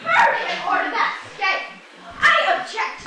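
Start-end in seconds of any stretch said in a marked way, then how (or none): 2.2-3.0 loud objection. Acted. Juror objects during jury argument.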